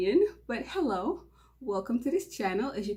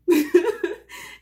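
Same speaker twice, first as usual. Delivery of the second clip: loud high giggle; embarrassed burst of laughter; nervous laugh; 8 semitones higher, 9 dB louder than usual. Real, not acted. Awkward laugh after joke.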